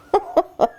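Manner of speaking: brief high chuckle; quick laugh at oneself; short laugh. Real, not acted. Breeder has them all on the wrong day, amused.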